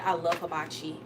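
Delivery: ordinary talk, nothing marked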